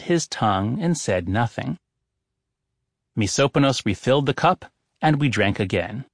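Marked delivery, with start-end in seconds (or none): none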